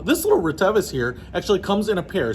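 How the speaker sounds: ordinary talk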